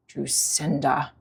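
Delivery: low, slow grumble; grumbled name. A narrator's reading. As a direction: mutter her name with disdain. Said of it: "Dewey grumbled".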